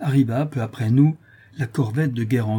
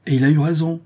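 higher, louder, heated retort; spirited outburst, pitched up and forceful; fiery exclamation; 5 semitones higher, 5 dB louder, faster than usual. A narrator's reading. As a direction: exclaim it with fire. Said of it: "s'écria monsieur Laffitte avec feu".

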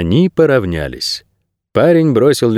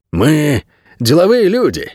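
slow, high-lifted drawl; smooth bargaining; 5 semitones higher than usual. A narrator's reading. Lodger trying to buy off the intruder, smooth bargaining. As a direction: draw the words out to negotiate, pitch lifted.

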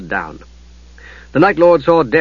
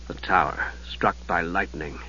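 much softer, low murmur; 7 semitones lower, 12 dB quieter than usual. Acted. Steele murmuring as he stares at the Night Lord. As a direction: murmur it to yourself in disbelief. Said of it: "he murmured".

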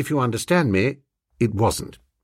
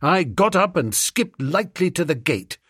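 ordinary delivery, nothing marked

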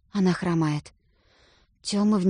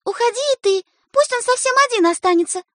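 very high, loud order; commanding; 13 semitones higher, 4 dB louder than usual. A narrator's reading. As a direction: boss the boy away. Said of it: "говорит повелительно".

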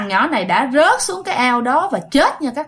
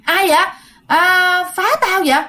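retold yell; 7 semitones higher than usual. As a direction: shout at the unseen knocker, a bit rattled. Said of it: "bạn mới la lên". (la lớn, cao giọng hỏi vọng ra cửa)

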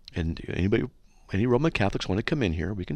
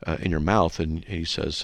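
ordinary talk, nothing marked

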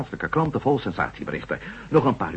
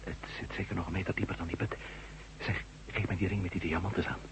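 hushed; 3 semitones lower, 11 dB quieter, faster than usual. Acted. Hushed talk at the pit.

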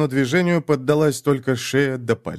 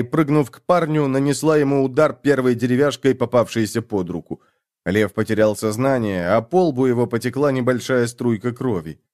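ordinary delivery

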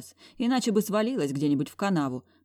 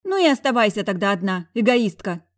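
loud, furious bark at her back; 4 semitones higher, 7 dB louder than usual. A narrator's reading. Friend barks after the leaving heroine, furious. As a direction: fling it after her angrily. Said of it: "в спину мне рявкнула Зая".

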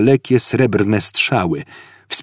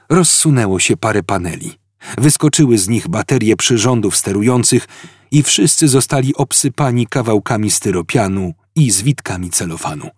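ordinary delivery

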